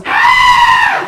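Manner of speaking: very high, loud screech; adrenaline scream. Retold or imitated. Shaken pedestrian to the driver's car, wild high scream. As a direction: screech as if words won't come out. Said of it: "the only thing I could get out was".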